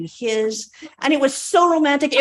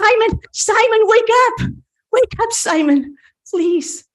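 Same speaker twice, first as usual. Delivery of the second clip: higher and louder, calling him awake; pleading call; 10 semitones higher, 4 dB louder than usual. Acted. Margaret calling to lifeless Simon, loud and pleading.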